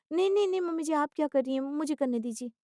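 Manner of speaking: flustered daughter-in-law objecting; protesting daughter-in-law. Acted. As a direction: protest politely, high and falling away.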